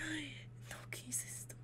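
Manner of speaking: barely voiced gasp of disbelief; shocked whisper. Real, not acted. Reacting to the story in a stunned whisper. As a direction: whisper it in shock, under your breath.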